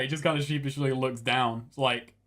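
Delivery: ordinary talk, nothing marked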